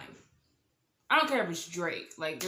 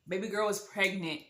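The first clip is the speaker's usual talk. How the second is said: ordinary delivery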